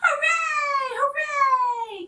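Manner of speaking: very high, loud cheer; cheering toys. Acted. Clown and toys cheering at the summit, very high and loud. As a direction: shout for joy at the top. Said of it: "cried the funny little clown".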